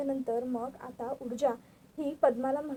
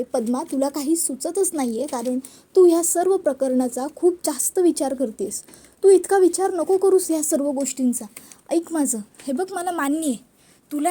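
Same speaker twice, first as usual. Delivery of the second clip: louder, insistent big-sister lecture; firm scolding; 7 dB louder than usual. Acted. Elder sister tells Padma to stop overthinking, loud and insistent. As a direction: raise your voice to snap her out of worrying.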